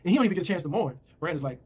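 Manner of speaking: ordinary talk, nothing marked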